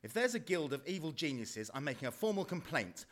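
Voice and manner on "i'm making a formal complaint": ordinary talk, nothing marked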